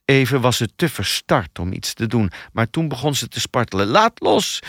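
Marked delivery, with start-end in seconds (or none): 3.9-4.6 high-pitched shouted demand; shouting. A narrator's reading. Struggling woman to the man carrying her, shouting. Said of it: "schreeuwde ze".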